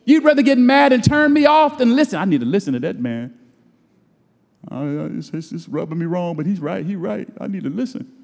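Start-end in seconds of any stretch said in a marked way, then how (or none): 2.2-8.1 reluctant listener. Retold or imitated. Voices a reluctant church listener.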